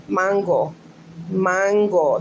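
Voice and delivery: mango in a Spanish accent, MAHN-go; Spanish pronunciation. Shown as a demonstration. Showing Luann how to say it, Spanish accent. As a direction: demonstrate Spanish mango with an open AH. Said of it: "That's how you say it in Spanish".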